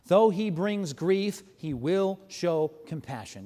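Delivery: Though said slow and loud, then it settles; weighty verse, stretched out; slow, emphatic reading. Shown as a demonstration. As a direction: lean hard on the opening, slowly. Preacher reading key verse.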